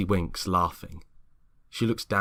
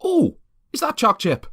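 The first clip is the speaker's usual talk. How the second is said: much higher, louder greedy squeal; delighted surprise; 9 dB louder than usual. Acted. Greg spotting biscuits in James's bowl, delighted. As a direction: spot the treat and pounce on it.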